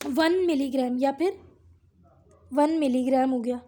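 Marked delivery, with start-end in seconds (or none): none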